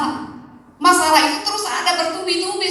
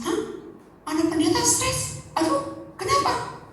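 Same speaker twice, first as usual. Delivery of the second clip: high-pitched startled gasp of disbelief; retold shock; 9 semitones higher, 5 dB quieter, slower than usual. Preacher reliving his shock on hearing of the pastor.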